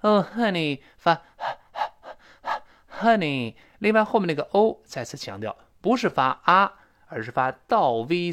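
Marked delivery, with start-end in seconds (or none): 0.0-3.5 "honey" with a breath-only h; corrected honey. Shown as a demonstration. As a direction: model "Oh, honey" higher, h unvoiced, short "uh" vowel. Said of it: "咱们不行啊".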